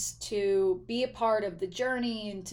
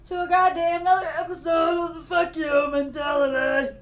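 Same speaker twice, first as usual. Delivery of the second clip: stretching groan; 7 semitones higher, 11 dB louder than usual. Real, not acted. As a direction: sing the intro while stretching hard. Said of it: "I was stretching".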